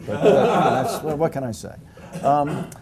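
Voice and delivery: laughing. Real, not acted. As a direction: chuckle at your own dig at Yale. Laughing after joke about Yale.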